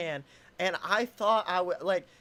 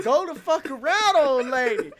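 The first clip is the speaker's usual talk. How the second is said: loud, higher, breaking into a laugh; retold exasperated shout; 6 semitones higher, 7 dB louder than usual. Stalled driver waving old lady around, exasperated shout. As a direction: yell it exasperated, half laughing.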